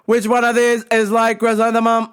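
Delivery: mock deaf man's over-projected question; yelling deaf customer. Deaf customer asks which product works, imitated yelling. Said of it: "he's yelling back at me".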